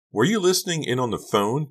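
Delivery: high-pitched accusing question; indignant teen confronting mom; indignant demand. A narrator's reading. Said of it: "Becca demanded".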